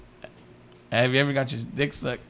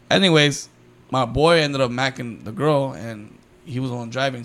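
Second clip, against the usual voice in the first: ordinary talk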